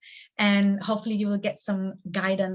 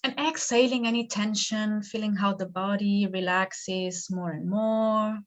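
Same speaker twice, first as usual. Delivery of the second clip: guided meditation. Acted. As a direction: lead them gently into stillness, stretching the words.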